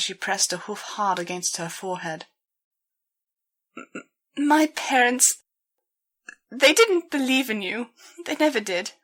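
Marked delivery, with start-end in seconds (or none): 3.8-8.9 higher, louder, halting and tearful; stuttering, near tears. A narrator's reading. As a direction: force the words out through the tears. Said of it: "She stuttered and trailed off".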